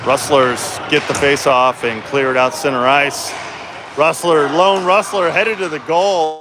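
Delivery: ordinary delivery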